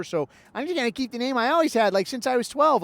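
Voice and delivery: quick, high imitation of a streamer; mock streamer. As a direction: lightly mimic a streamer explaining himself. Mimics streamer justifying childish name.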